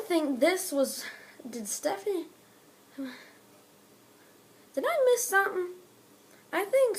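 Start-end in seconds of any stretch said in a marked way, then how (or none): 1.5-2.3 unvoiced confused whisper; puzzled whisper. Real, not acted. 4.8-5.7 high puzzled question; high confused surprise; surprised. Real, not acted.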